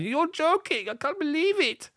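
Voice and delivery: put-on very high, squeaky German accent. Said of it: "that was my German accent".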